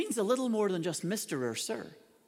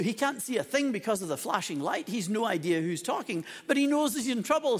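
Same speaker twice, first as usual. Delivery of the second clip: ordinary talk, nothing marked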